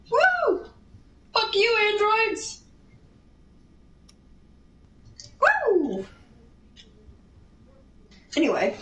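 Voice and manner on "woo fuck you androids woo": much higher, whooping victory cheer; excited cheer. Real, not acted. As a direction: whoop it up after the win in the game. Gamer celebrating grabbing a Dragon Ball.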